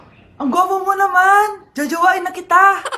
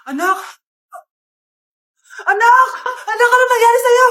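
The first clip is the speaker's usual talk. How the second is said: loud, high panicked calling; mock panic yelling; alarmed shout; 7 semitones higher, 5 dB louder than usual. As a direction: call out in alarm for the child. Mother finds child collapsed.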